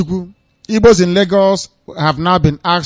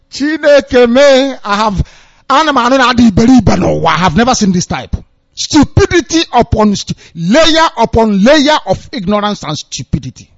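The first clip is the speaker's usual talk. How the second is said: raised, loud cry of disbelief; incredulous exclaiming; 5 semitones higher, 3 dB louder than usual. Real, not acted. Host appalled at people's stupidity, exclaiming in disbelief.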